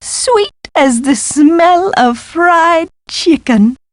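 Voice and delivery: high, slow, drawn-out relish; savouring delight. Acted. Professor relishing her find, high and slow.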